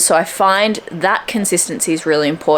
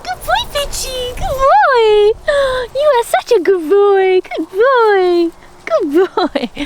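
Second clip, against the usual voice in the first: very high, squeaky dog-praise voice; praising her dog Fitchy in a high squeaky voice; slower than usual. Real, not acted. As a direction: squeak it high, like rewarding a puppy. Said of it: "like, a high squeaky voice when you reward them".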